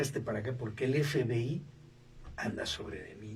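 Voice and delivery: low, hushed secret warning; hushed conspiratorial. Retold or imitated. Patient warning the psychiatrist quietly that the FBI watches him. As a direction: lean in and warn quietly about being watched.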